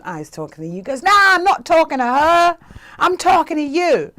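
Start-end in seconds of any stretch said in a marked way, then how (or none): 1.0-4.1 loud, high, emphatic correction; insistent calling. Retold or imitated. Man telling her she is the one, loud insistent call. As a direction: call it across a concourse to the right person.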